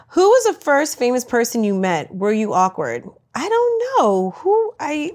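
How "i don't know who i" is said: squeaky surprise. Real, not acted. Flustered at question.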